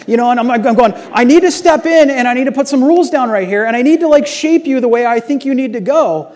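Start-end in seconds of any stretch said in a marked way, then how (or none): 1.1-6.3 high, loud lecturing of a daughter; hammed-up angry dad laying down rules; stern lecture. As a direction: lay down the rules, raising the voice. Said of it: "I'm, I'm lecturing her".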